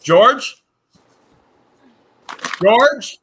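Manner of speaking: ordinary delivery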